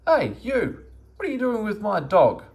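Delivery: high, loud angry yell from across the road; angry shout. A narrator's reading. Woman shouting across the road at Meddle, angry and loud. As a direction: shout at him, furious and shrill-high. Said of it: "in a very angry voice".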